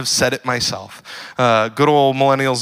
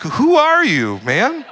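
much higher, baffled mock disbelief; incredulous; 12 semitones higher, much slower than usual. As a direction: react like your buddy turned into a stranger. Reacting to friend's fake prayer.

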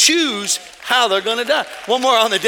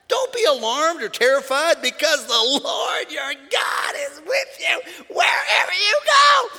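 preaching scripture; 6 semitones higher, slower than usual. Real, not acted. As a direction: lift the verse into a cry.

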